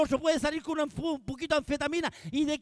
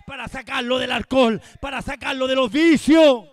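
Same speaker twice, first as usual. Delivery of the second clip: ordinary delivery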